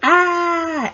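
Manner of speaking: very high, loud cry of pain; sharp pain cry. Retold or imitated. Patient crying out in pain during a stomach spasm. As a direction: cry out from a cramp.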